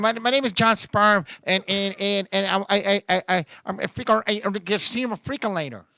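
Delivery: low, stammering caller in broken English; Spanish-speaking caller. Acted. Confused caller explaining his name to the operator, low stammering. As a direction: stumble and restart as you explain yourself.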